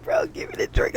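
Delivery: high-pitched, insistent plea; urgent retold demand. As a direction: hurry him along in a squeaky-high voice. Urgent demand acted in story.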